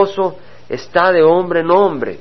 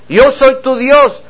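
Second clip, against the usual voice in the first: higher, louder, slow declaration; proclaiming God; 8 semitones higher, 4 dB louder, slower than usual. Acted. Preacher to congregation proclaiming God's words, loud and slow. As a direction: ring it out as God's own claim.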